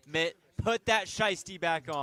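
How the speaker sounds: loud call across the room. Real, not acted. Calling out to player across room.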